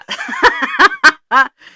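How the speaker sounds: high burst of laughter; loud laugh. Real, not acted. Host laughing at the co-host's mock accent.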